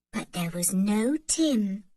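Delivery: plain reading voice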